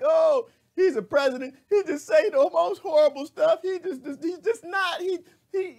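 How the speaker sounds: high, loud, squealing mock outrage; mocking outraged people. Host mocking critics of the president, high mock outrage. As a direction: parody the shocked critics in a shrill squeal. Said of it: "Y'all, it's like".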